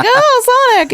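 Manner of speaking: a huge high squeal on Oh, then Sonic; mock surprise. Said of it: "and I'm like".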